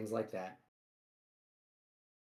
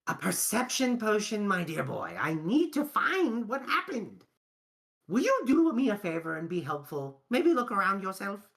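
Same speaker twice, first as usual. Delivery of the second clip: put-on higher, louder theatrical old gent voice; eccentric old alchemist; 7 semitones higher, 3 dB louder than usual. Alchemist asks the adventurers for help, in a higher, louder grand voice. GM voices the potion-drinking NPC.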